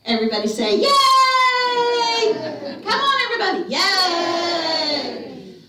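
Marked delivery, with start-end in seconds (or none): 0.8-2.3 long, loud, high "yay"; big cheer. Pretend. Leads audience in cheering. 2.8-5.6 high, loud call to the crowd; rallying cheer. Pretend. Rallies audience to cheer louder.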